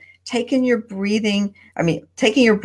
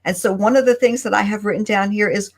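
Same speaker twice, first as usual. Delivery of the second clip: ordinary delivery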